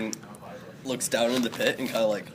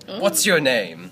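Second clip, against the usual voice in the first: slow, loud kingly demand; questioning king; 5 dB louder, much slower than usual. Acted. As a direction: draw the question out in a commanding voice. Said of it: "The king looks at him and says".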